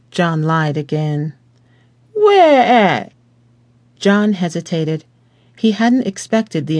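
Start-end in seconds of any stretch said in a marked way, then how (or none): 2.1-3.1 higher, louder clipped demand; gruff Southern cop. A narrator's reading. Officer grilling the driver, gruff Southern demand. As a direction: bark it like a suspicious lawman.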